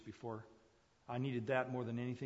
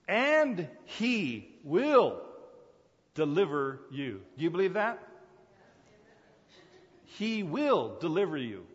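slow, drawn-out, louder pulpit promise; preacher assuring the congregation, slow and loud; preaching; 5 semitones higher, 10 dB louder, slower than usual. Real, not acted. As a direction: stretch the words out like a revival preacher.

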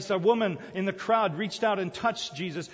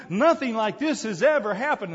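louder, laughing crowd cry; crowd shouting; 3 semitones higher, 6 dB louder than usual. Retold or imitated. Preacher voicing the amazed crowd, joyful shout. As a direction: call it out like a thrilled crowd. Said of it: "and they're shouting".

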